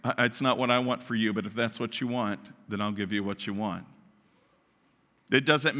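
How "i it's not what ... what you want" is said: much lower and softer, letting go; quiet surrender, giving them up; resigned God. Acted. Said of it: "he's like".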